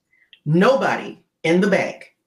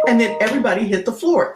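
ordinary talk, nothing marked

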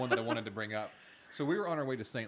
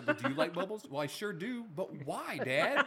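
lightly voiced kid, higher, asking why; son insisting; 7 semitones higher, slower than usual. Dad retelling his son's persistent why, lightly higher. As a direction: play the boy pushing for an answer.

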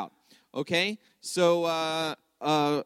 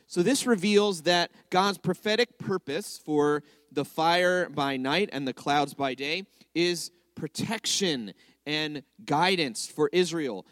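ordinary delivery

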